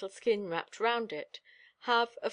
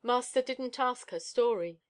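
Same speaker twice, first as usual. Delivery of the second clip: usual speaking voice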